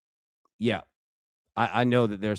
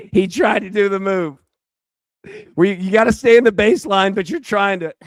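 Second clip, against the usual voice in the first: excited shout; 9 semitones higher, 8 dB louder than usual. Real, not acted. Yelling reaction at the baseball game.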